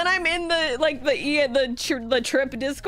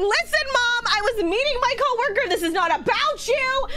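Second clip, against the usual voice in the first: higher, defensive protest; retold arguing back; 7 semitones higher than usual. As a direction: argue back at mom, pitched up. Retold argument with mother.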